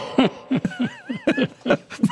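high-pitched cackle of laughter; laughing fit; helpless laughter. Real, not acted. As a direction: crack up uncontrollably. Host cracks up at played clip.

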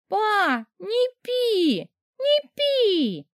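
put-on very high baby cries of a little girl; a toddler begging her still father, very high. A narrator's reading. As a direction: call out like a tiny child.

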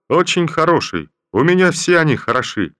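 captain's self-assured reply to the general. Acted. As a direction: state it with total self-assurance. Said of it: "своим обычным самоуверенным тоном".

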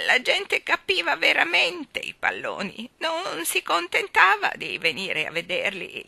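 put-on loud, slow, quavering old balloon seller; trembling old woman. Balloon woman chides the children, in a loud trembling old voice. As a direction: scold them like a frail old woman full of passion.

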